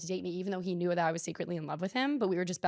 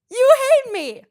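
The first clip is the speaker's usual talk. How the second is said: high, loud sung-out accusation; shrill theatrical wail; mock shriek; 13 dB louder than usual. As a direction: hit it way up high for comedy.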